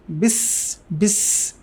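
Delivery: বিস with a thin light s; light s sound. Shown as a demonstration. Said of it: "সিনটা খুবই পাতলা হবে". (পাতলা স উচ্চারণ)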